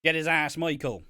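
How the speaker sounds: hammed-up Irish farmer ringside. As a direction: cheer him on like a rural fan. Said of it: "in the farmer voice".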